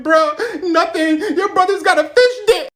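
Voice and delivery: high, loud laughing delivery; laughing punchline. Retold or imitated. Answering his best friend's brother, high and laughing. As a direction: crack up through the punchline.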